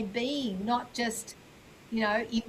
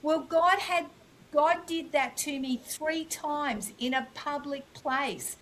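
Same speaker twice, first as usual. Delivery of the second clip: usual speaking voice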